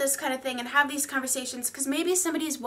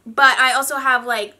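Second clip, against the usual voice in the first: normal talking voice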